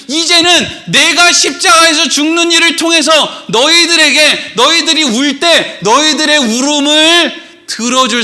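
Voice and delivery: high, emphatic proclamation; Jesus. Acted. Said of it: "여기서 예수님이".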